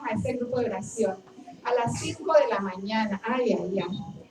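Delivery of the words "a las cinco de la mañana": normal talking voice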